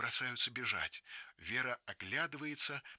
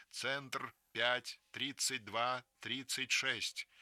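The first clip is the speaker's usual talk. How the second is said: «пять тридцать два тридцать шесть» read out very slowly, digit by digit; slow, deliberate number for listeners to write down; slow announced phone number; 2 semitones higher, much slower than usual. Acted. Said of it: "продолжает репродуктор".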